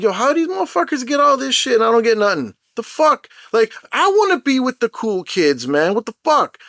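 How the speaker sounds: high, whiny mock complaint; fast, high-pitched exasperated griping; left-out dummy. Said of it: "feeling like the total dummy".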